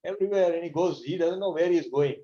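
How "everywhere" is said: high, giddy, carried-away voice; a blissed-out sage spinning with joy; ecstatic Narad. Retold or imitated. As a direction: sing it out like he's dancing in bliss. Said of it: "he becomes ecstasy".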